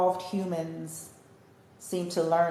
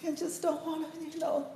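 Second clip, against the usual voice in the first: higher and softer, mocking meekness; mock timid voice; 9 semitones higher, 9 dB quieter than usual. Mimicking timid people to her viewers, high soft mock. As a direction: play the scared one wanting peace. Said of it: "as long as they think you're just".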